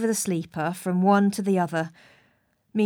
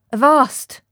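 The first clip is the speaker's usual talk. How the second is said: a sudden loud, higher call; loud cry; 5 semitones higher, 8 dB louder than usual. Acted. Stranger behind the two men calls them to halt, loud cry. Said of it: "cried a voice".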